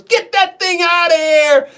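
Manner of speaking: mock yell, high, slow and loud; imitated yelling. Son-in-law imitating his mother-in-law refusing beaver, mock yelling. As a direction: yell it drawn-out, sending up an outraged mother-in-law. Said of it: "she just yelled at me".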